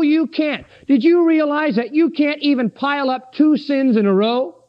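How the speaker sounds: ordinary delivery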